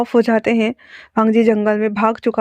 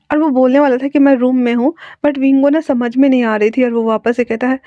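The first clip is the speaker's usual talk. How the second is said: ordinary delivery, nothing marked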